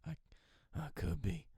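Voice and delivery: understated private comeback; under the breath. Retold or imitated. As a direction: say it to yourself, quietly. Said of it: "under my breath".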